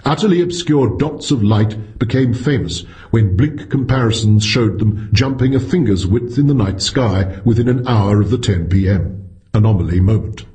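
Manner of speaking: plain reading voice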